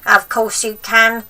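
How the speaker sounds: loud, slow, curt snap; snapping. Acted. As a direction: slap the answer down like a cross old woman. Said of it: "she snapped".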